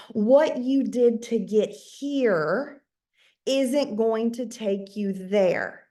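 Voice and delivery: very slow, weighty delivery; slow, deliberate punchline; slow emphasis. Real, not acted. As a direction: land the key lesson slowly. Key message, wrapping up.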